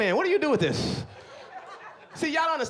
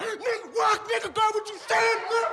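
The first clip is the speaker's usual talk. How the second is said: much higher, screaming furious street guy; Hulk-like rage yell. Retold or imitated. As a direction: blow up like the Hulk, shrieking high.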